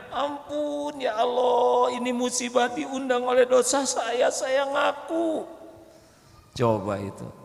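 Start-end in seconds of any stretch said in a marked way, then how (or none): 0.1-5.5 repentant plea. Acted. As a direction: beg forgiveness with a breaking cry. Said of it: "dan tobatnya".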